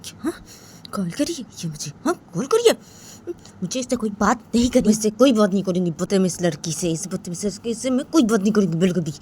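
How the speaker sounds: voiced girl snapping crossly to herself; a huffy girl refusing to talk; annoyed grumble. A narrator's reading. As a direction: grumble angrily at the phone. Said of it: "अपने हाथ पर बोलती है".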